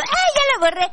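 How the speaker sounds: high squeal of panic; mock panic over deleting; panicked squeal. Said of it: "Así vas a hacer cuando".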